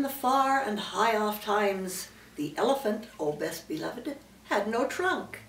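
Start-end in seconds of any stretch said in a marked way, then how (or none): none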